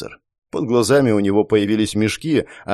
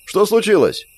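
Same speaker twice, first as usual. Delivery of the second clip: high startled woman; 10 semitones higher than usual. Acted. Another voice in the crowd.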